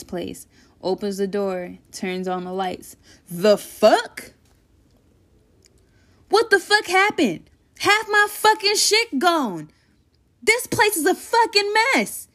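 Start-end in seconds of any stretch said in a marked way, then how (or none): 3.3-12.3 high, outraged shouting; heated. Acted. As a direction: blow up at the mess. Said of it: "heated to the fucking max".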